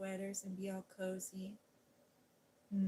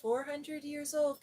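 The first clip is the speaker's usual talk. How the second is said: louder and higher, marvelling at the number; amazed repeat; 6 semitones higher, 8 dB louder than usual. Acted. Storyteller repeating the house's age to amaze viewers, louder. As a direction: sell the wonder of it to a child. Repeats the whispered line aloud.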